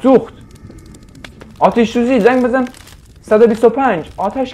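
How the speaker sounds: high, loud panicked yelling; panicked shout. Real, not acted. Gamer panicking as the room catches fire, shouting. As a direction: yell for help as the fire starts.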